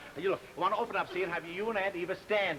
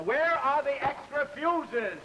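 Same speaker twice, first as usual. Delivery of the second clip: high, drawn-out holler; exasperated calling out; 7 semitones higher, 4 dB louder, much slower than usual. Acted. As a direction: call slowly through the house, pitched high. Calls out hunting for fuses.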